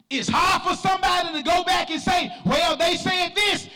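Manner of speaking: high, driving church sermon delivery; full-force preaching. Real, not acted. Preacher exhorting the church to be different, full-force preaching. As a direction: preach it hard, stretching the phrase endings.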